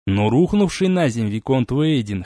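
ordinary delivery, nothing marked

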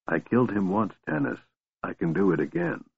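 very soft, chilling threat; quiet menace. A narrator's reading. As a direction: barely raise your voice. Raistlin's soft, menacing reply.

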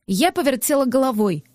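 plain reading voice

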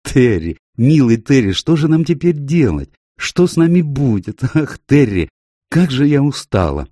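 plaintive, worn-out appeal; weary lament. Acted. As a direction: pour out your troubles to the dog. Tired owner laments to her dog.

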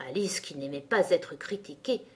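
plain reading voice

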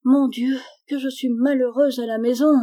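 put-on a touch of a child's wailing complaint; tearful outcry. A narrator's reading. Daughter laments her life at home, mildly tearful outcry. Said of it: "s'écria Gisèle en fondant en larmes".

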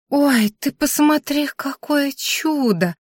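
high and slow, marvelling; exclaiming in delight. Acted. Grandma marvelling at the waiter, high and drawn-out. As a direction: gasp it out like seeing a miracle. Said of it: "воскликнула Ба".

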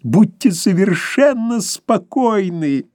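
high, slow, elated assurance; ecstatic. A narrator's reading. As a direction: beam with joy. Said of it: "в экстазе воскликнул гость".

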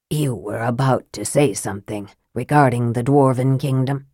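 low, soft, sly mage; Raistlin's voice. A narrator's reading. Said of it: "Raistlin speaks to Tanis".

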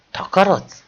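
high, forceful bellow to get out; angry shout. A narrator's reading. Tony drives the servant off with a furious shout. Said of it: "kiabálta Toni".

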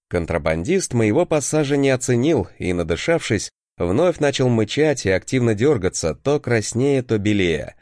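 ordinary delivery, nothing marked

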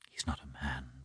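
a sudden bitter whisper. Acted. Anna whispering to Vronsky about her husband. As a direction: breathe it out without voice.

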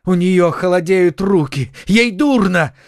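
high, urgent alarm; panicked cry. A narrator's reading. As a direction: cry out in fright for someone collapsing. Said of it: "закричал Д'Артаньян".

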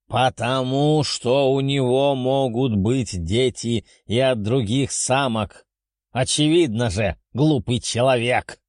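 slow, contemptuous beast voice; scornful dragon. Acted. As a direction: scold like an impatient dragon. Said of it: "рыкнул дракон".

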